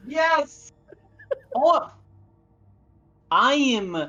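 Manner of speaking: real fluster, voice cracking high; laughing at own failed accent. Real, not acted. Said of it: "oh, God".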